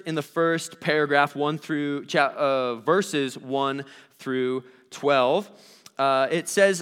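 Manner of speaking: ordinary delivery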